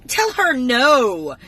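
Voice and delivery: slow defiance. Real, not acted. Outraged refusal.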